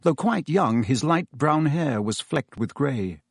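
ordinary delivery

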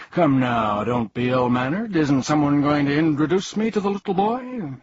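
put-on a vain old fraud demanding attention; pompous humbug. Humbug demanding an introduction to Milo, pompous and loud.

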